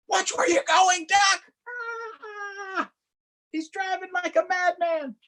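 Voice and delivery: high shrieking hen yelling at the duck; alarmed chicken. Acted. Chicken scolding reckless Duck, high and shrill. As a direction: squawk in panic.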